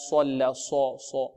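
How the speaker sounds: صو said soft with rounded lips; quiet wrong rounding. Shown as a demonstration. Teacher quietly demonstrating the rounded ص mistake. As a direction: let ص slide into an O as the error. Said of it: "ضم الشفتين، خطأ".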